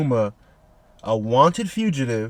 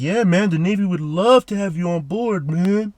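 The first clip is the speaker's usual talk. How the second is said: cheerful mock navy recruiter; laid-back Kizaru voice; 5 semitones higher, 3 dB louder than usual. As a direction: voice him as relaxed and unbothered, a touch higher.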